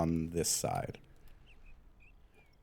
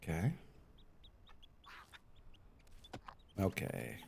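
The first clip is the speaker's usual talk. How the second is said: talking while holding breath; breath held, tight and quiet; 2 semitones lower, 14 dB quieter than usual. Real, not acted. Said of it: "holding my breath".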